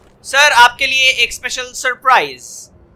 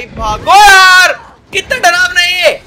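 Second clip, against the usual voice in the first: frightened yell; 7 semitones higher, 9 dB louder, slower than usual. Real, not acted. Fleeing the scary monster.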